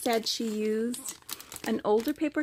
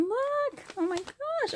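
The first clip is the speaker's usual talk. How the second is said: excited. Real, not acted. Excited unboxing.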